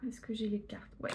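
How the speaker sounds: breathy half-voiced question to herself; a quick muttered aside, not for the camera; whispered aside. Real, not acted. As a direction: whisper it to yourself while looking for something.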